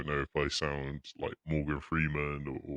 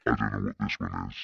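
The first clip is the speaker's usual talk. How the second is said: much lower voice changer; deep voice filter; 7 semitones lower, 5 dB louder than usual. Pretend. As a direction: drop it way down with a filter. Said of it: "that's even deeper somehow".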